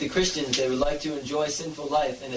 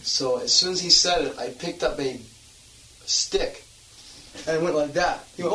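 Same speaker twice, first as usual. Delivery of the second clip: ordinary talk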